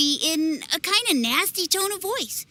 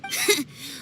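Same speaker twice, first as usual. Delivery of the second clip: shy laugh; 5 dB quieter than usual. Acted. Boy flattered by praise.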